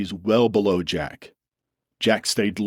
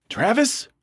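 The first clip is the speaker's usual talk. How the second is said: high calling yell. A narrator's reading. Dylan calls out to his friend, yelling. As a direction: call out across the slope. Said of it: "he yelled".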